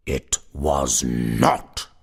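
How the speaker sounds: slow, loud, low unearthly voice; deep voice. A narrator's reading. Unearthly figure answers the startled sexton, deep voice. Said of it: "said a deep voice".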